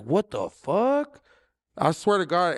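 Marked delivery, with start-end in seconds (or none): 0.0-1.2 higher, slow, drawn-out put-on voice; mock shocked what the fuck. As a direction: stretch it out in disbelief. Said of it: "it's like".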